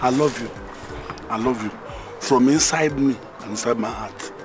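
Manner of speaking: tender pleading. Acted. Pleading love confession.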